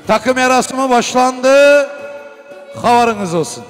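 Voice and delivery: slow, loud ceremonial announcement; booming wedding announcer. Real, not acted. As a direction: proclaim it to the whole hall.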